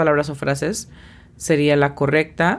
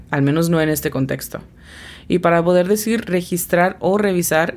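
normal talking voice

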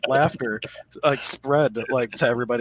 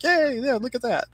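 mock cheer; 13 semitones higher than usual. Shaman teachers cheering student.

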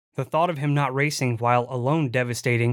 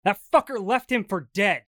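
raised bitter rant; angry; 7 semitones higher than usual. Acted. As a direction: spit it out in anger. Furious rant about previous owner.

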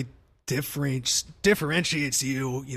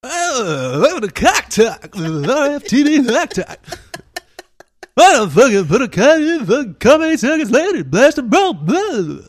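loud, high, hyped radio shock jock; shock jock radio host; 9 semitones higher, 11 dB louder than usual. Acted. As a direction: bark it like a crazed morning DJ. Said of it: "your shock jock voice".

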